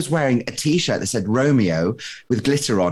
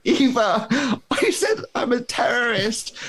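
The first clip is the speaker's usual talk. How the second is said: panicked call; 12 semitones higher than usual. Retold or imitated.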